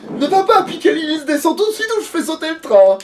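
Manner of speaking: high menacing villain threat. Acted. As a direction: shout the threat high and loud. Villain threat read aloud.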